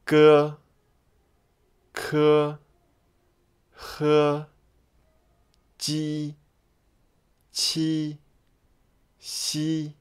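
G K H J Q X as drawn-out level syllables; read-after-me pronunciation. Shown as a demonstration. Teacher has learners read after her, drawn-out demo. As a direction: model each Pinyin initial, pausing for repeats. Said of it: "you may read after me".

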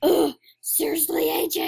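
loud, high "ugh" of annoyance; exasperated. Real, not acted. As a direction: call out a playmate, fed up. Exasperated at playmate in game.

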